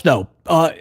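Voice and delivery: ordinary delivery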